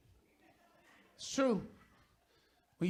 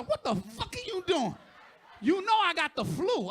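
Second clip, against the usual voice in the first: higher, louder irritated wife; a hint of an irritated wife; 7 semitones higher, 5 dB louder than usual. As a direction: snap back like a fed-up wife. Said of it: "She said".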